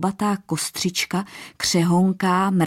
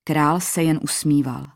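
usual speaking voice

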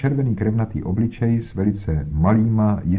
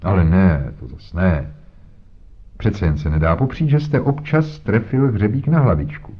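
pompous inspector. Acted. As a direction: lecture Holmes with condescension. Voiced inspector Jones talking. (nafoukaný inspektor, výš posazený hlas)

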